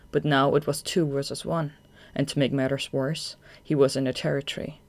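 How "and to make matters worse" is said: usual speaking voice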